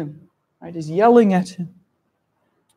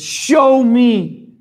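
loud, higher angry lion command; angry demand; 5 semitones higher, 8 dB louder than usual. Acted. Lion demanding proof from the king hare, angry. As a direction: bark it like a furious lion. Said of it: "this makes the lion very angry".

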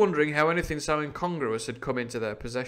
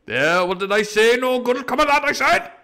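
much higher, louder pirate voice, told-you-so; Morgan gloating; 11 semitones higher, 9 dB louder than usual. Acted. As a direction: crow that you warned them. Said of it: "said Morgan".

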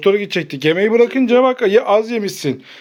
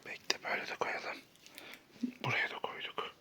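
barely audible whisper; secretive whisper; 22 dB quieter than usual. Real, not acted. Secretly hiding items from child.

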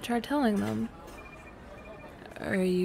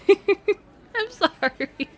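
slow, loud, high apology through giggles; laughing apology; 11 semitones higher, 7 dB louder, much slower than usual. Real, not acted. Apologizing to her partner while still giggling.

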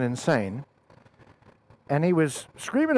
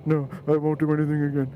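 mock meek, chastened son; sheepish. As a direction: imitate a scolded son giving in. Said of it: "He sheepishly is looking down".

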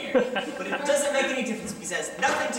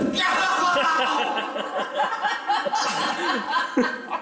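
exasperated shouting laugh; 5 semitones higher than usual. Acted. Clerk explodes.

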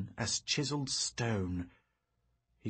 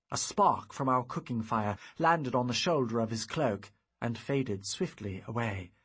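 ordinary delivery

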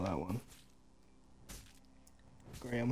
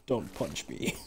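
a lower-voiced mock plea; 10 semitones lower, slower than usual. Imitating the game son begging the father, lower mock plea. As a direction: drop the pitch and plead lightly. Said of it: "he was like".

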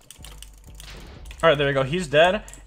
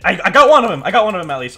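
excited; 5 semitones higher, 10 dB louder, faster than usual. Real, not acted. Trap worked again, celebrating.

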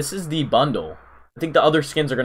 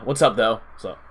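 ordinary delivery

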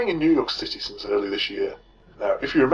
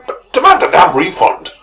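loud; 5 semitones higher, 9 dB louder than usual. Real, not acted. Ranting about Christine.

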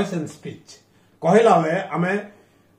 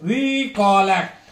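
recollect drawn out slowly by syllables; slow teaching demo of recollect, weight after re; second-syllable stress; slower than usual. Shown as a demonstration. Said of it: "If re sound second syllable".

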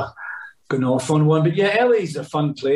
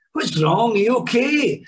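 Billy Connolly; 9 semitones higher than usual. Retold or imitated. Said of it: "he's like".

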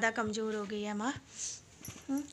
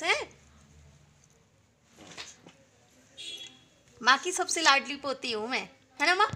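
coaxing grandma; 4 semitones higher than usual. Real, not acted. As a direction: cajole her kindly into eating more.

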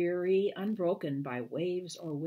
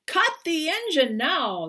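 captain's order; 8 semitones higher, 7 dB louder than usual. A narrator's reading. Captain giving an order at sea.

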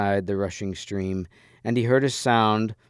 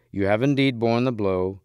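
plain reading voice

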